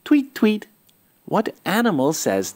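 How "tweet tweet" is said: high chirpy little bird call; bright, high birdsong tweet. Acted. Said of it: "It says".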